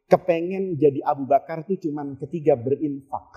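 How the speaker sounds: ordinary talk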